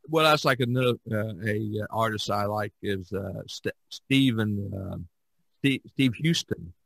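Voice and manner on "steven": normal talking voice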